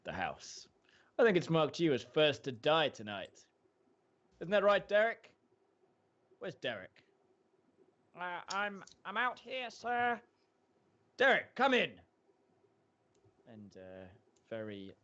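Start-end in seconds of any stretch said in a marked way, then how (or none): 0.0-0.7 ominous whisper. Acted. Husband voiced menacingly. 1.2-7.0 smug, loud call; sinister host voice. Acted. Husband voiced, calls for Derek. 8.2-10.2 high, hesitant and nervous; timid voice. Acted. Nervous owner answers from outside. 11.2-12.0 high, loud summons; commanding host. Acted.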